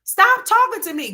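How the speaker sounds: high, slow mock complaint; mimicked defiant boy. Imitating a little boy telling someone off, high and insistent. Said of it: "He's like".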